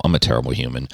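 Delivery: ordinary talk, nothing marked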